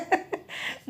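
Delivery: a quick soft laugh under the breath; soft breathy laugh. Real, not acted. Mother laughing softly about son's Punjabi test, soft laugh.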